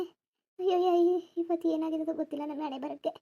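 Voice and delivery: subdued wail of self-pity; weary, grieving moan; woeful lament. Acted. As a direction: bemoan your fate under your breath. Woman bemoaning her fate while waiting.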